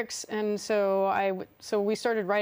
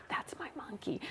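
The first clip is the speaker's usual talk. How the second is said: breathy near-silent whisper; whispered through tears; 5 semitones higher, 12 dB quieter than usual. Retold or imitated. Whispering to the little girl behind the ropes, choked up. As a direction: barely voice it, choked up. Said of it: "I said".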